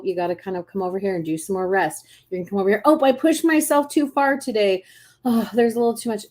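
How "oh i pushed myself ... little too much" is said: mock dismay. Voicing someone who overdid it.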